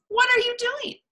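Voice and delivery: high fox character voice; high-pitched inquisitive fox; curious Fox. A narrator's reading. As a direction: ask it high, like a little animal. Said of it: "said Fox".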